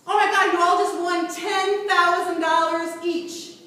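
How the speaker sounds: loud, slow, sing-song thrilled shout; excited announcement. Shown as a demonstration. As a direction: sell it like a game-show host announcing a prize. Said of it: "What's the posture of excitement?".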